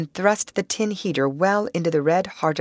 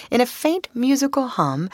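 ordinary delivery

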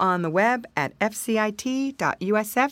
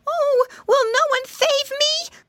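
very high, slow wail; hammed-up squealing distress; frightened piglet cry; 4 dB louder than usual. A narrator's reading. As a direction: plead for rescue in a tiny pig's voice. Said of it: "cried Flop".

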